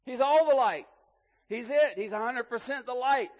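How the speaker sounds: emphatic proclamation. Real, not acted. Preacher exalting God's holiness.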